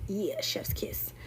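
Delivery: soft unvoiced chef's kiss; whispered praise. Real, not acted. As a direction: breathe it out like a secret compliment. Praising favourite album.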